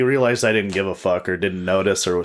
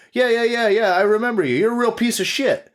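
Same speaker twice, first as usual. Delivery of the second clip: high mock homeless guy; 13 semitones higher than usual. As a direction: sneer it high and fast. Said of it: "He goes".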